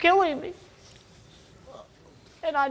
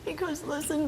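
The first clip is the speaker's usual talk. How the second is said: whisper; 5 semitones higher, 7 dB quieter than usual. Real, not acted. Mother pleading with daughter.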